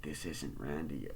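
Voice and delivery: low, slow, soft menace; creepy caller. Retold or imitated. Killer on the phone correcting Sydney, slow and low. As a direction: let each word sink in darkly. Killer denies being Randy.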